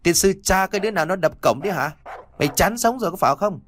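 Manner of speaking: high, fast angry yelling through the gate; irritated cursing. Acted. Servant cursing at whoever bangs the gate, angry and fast. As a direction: curse the intruder, fast and high. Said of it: "những tiếng đầy bực dọc".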